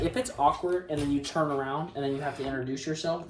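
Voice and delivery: usual speaking voice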